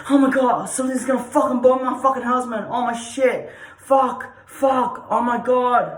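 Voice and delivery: loud, high panicked yelling; panicking. Shown as a demonstration. Showing the class stress energy, shouting in panic. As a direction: freak out in a frantic shout. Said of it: "stress energy is like".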